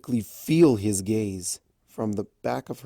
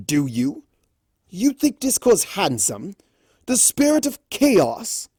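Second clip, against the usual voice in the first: rising higher in disbelief; incredulous demand; 9 semitones higher, 4 dB louder than usual. A narrator's reading. Raspberry confronting Fluttershy, incredulous. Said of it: "whipped his head over to Fluttershy".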